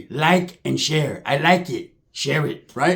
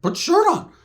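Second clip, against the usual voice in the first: high yelled order to put her shirt on; shrill mock scold, much higher; yelling at Sheila; 13 semitones higher than usual. Walking in on Sheila, yelling at her to cover up with a high mock yell.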